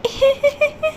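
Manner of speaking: high eerie giggle; ghostly giggle. Acted. Ghost laugh.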